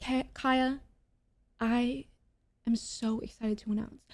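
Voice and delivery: soft, halting mock announcement; hushed, drawn-out parody of a reveal; imitating halting announcer. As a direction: dole out the words with long dramatic pauses. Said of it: "the way Jess fucking says this".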